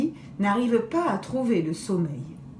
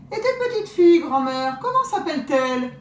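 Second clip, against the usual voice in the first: high, smacking greedy wolf; 7 semitones higher, 6 dB louder, faster than usual. Acted. Wolf quizzing grandmother about her granddaughters, greedy and sly. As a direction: lick your chops and pry sweetly.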